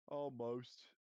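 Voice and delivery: a touch of ghostly regret; sad ghost. A narrator's reading. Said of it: "said the ghost sadly".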